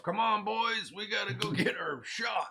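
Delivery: put-on high rallying call to the boys; rally the men like a union boss; tough union boss. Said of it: "Jimmy Hoffa is like".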